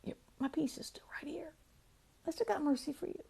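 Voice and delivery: soft, unvoiced, gentle reply; calm whisper. God answering the panicked speaker, calm whisper. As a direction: breathe it out like a calm reassurance. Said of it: "And he just goes".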